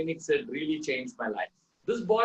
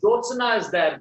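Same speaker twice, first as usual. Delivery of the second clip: raised, insistent patient voice; a hint of an entranced patient, louder; patient talking to wall; 6 semitones higher, 7 dB louder than usual. Ward patient to an unseen figure: insistent, a bit louder. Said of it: "She was saying".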